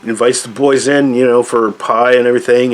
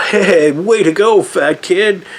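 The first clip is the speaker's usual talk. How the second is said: high, slow mock cheer in a kid's voice; imitating kids cheering, pitched up; 8 semitones higher, slower than usual. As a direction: cheer him on like a kid behind glass. Kids cheer through the glass.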